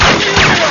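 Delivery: loud anguished "aiyo" wail. Acted. Brother-in-law fears for drowning relative, loud wail. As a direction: cry out in despair.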